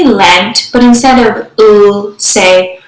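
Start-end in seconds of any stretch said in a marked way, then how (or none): 1.6-2.2 "l" held as "ull"; just the sound "l", drawn out; isolated phoneme. Shown as a demonstration. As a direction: sound out "l", not "el". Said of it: "substituting phonemes".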